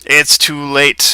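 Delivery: flat synthetic robot voice. Acted. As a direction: speak like a computer. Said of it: "says the robot voice".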